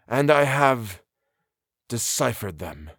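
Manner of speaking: pause, then drop slowly on the last words; ring like iron. A narrator's reading. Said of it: "said the other in a voice with a ring like iron".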